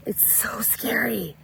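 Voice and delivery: slow, hushed and breathy, like telling a ghost story; spooky whisper. Pretend. Vlogger whispering to viewers that it's all so scary, slow hushed whisper. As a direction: whisper it slowly as if it's frightening. Mock-spooky aside about Orwellian times.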